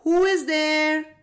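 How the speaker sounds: plain reading voice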